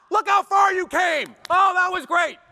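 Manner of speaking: loud, high, overjoyed cheer; cheering parent. Retold or imitated. Father clapping for the child who just crashed, loud cheering. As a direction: cheer the kid on like a proud dad.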